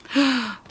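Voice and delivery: sharp little gasp of delight; surprised gasp. Real, not acted. Crafter sees her dot land perfectly, gasps. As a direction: gasp as the dot lands right on.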